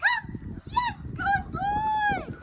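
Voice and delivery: be exciting so he runs to you; excited recall. Shown as a demonstration. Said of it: "You wanna be very exciting".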